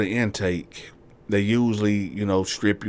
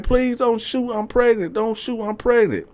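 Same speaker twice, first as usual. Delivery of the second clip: much higher, begging; pleading woman; 14 semitones higher than usual. Retold or imitated. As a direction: plead for mercy. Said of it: "So she like".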